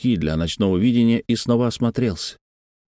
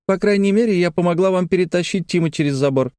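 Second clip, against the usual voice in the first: put-on high, self-satisfied child voice; boastful girl; 9 semitones higher than usual. A narrator's reading. Sister boasts of helping over the fence, high bragging voice. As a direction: brag as a little girl, pitched well up. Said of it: "похвасталась Лекси".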